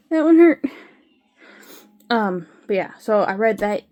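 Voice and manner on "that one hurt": pained complaint. Real, not acted. Reacting to being hurt by cat.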